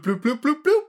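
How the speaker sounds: high popping bloops for a forming puddle; bloop sounds. Retold or imitated. Describing puddles to co-host, high popping bloop noises. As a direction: pop out each bloop like a bubble.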